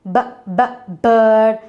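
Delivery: "B, b" as short buh bursts, then "bird"; sounding out. Shown as a demonstration. Teacher shows which sound bird has, sounding out. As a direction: isolate the first sound of "bird" twice. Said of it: "What is the sound the bird has?".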